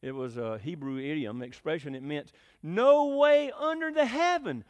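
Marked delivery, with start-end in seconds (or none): 2.6-4.6 high, loud and drawn-out proclamation; emphatic declaration. Retold or imitated. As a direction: declare it forcefully, stretching each word out. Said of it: "very, very emphatic".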